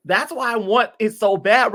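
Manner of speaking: normal talking voice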